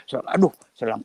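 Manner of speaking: usual speaking voice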